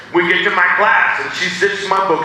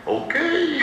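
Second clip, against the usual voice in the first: higher nervous okay with a laugh; 12 semitones higher, 6 dB quieter than usual. Retold or imitated. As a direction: squeak it out timidly.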